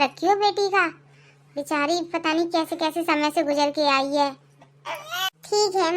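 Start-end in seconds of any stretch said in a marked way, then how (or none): none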